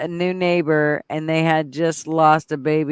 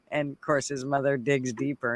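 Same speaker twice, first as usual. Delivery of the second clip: ordinary delivery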